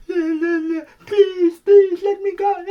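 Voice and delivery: very high, crying, hammed-up begging; high sobbing mock plea, slowing at the end. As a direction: wail for mercy like a caught villain, stretched out. Police officer begs to be freed.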